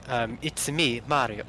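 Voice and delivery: low, restrained British accent; English accent. Shown as a demonstration. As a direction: say the catchphrase in understated British. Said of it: "in your English accent".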